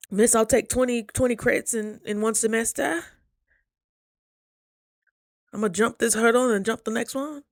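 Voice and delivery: lightly hammed-up eager student promise; mock overachiever. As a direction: imitate the overachieving student bragging. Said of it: "I know you".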